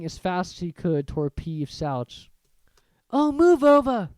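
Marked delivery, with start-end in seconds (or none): 3.1-4.1 high, louder impatient snarl; bossy girl snapping, higher and louder. A narrator's reading. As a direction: shove past them, irritated. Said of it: "Hermione snarled".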